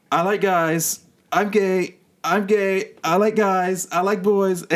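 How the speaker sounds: high-pitched, rushed confession; high, nervous coming-out blurt to his roommates. Retold or imitated.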